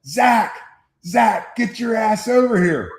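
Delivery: high, retold sideline yell; Coach Baker yelling. Coach calling the son into the game, imitated yell. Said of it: "you hear Coach Baker yelling".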